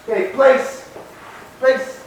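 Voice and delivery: high, bright command to the dog. Real, not acted.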